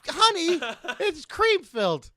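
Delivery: high, loud questioning; mock husband. Husband interrogating his wife about the cookies, high and loud.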